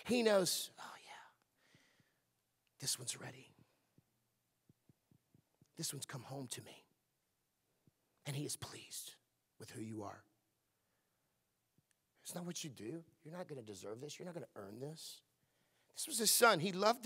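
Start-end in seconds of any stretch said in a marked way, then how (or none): 0.8-6.8 soft, near-whispered pleased father; hushed, tender fatherly murmur. Acted. 8.2-15.2 soft, low reassuring preacher; quiet sincere reassurance; tender hush. Real, not acted. Soft intimate preaching lull.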